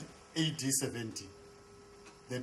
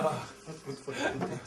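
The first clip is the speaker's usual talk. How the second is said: laughing. Real, not acted. Repeats his own remark, laughing.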